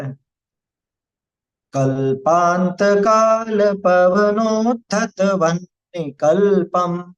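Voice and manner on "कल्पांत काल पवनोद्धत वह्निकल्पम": slow chanted Sanskrit shloka, each syllable drawn out; slow verse recitation. Shown as a demonstration. Teacher chanting the shloka for the class, slow and measured. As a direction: intone the verse slowly and reverently.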